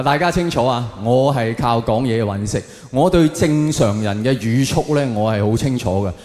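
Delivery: usual speaking voice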